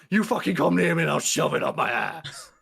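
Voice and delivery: hammed-up unhinged yell; imitated screaming. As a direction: act out a screaming threat, half in earnest. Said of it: "she's just screaming, like".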